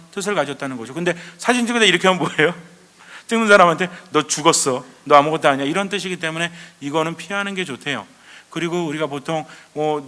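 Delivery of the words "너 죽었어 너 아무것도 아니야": loud, emphatic acted put-down; mock threat. Someone posing to the photographer, loud mock threat.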